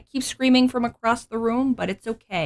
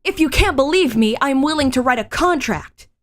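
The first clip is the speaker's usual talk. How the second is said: high, louder insistent heroine; anime heroine voice; 6 semitones higher, 5 dB louder than usual. Acted. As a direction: insist eagerly. Female lead insisting.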